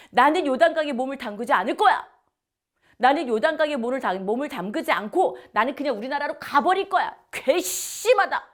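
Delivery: stubborn loud fury, spitting the refusal; angry refusal. Acted. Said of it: "이렇게 화를 내고 있는 거예요". (크고 빠르게 화내며 거부)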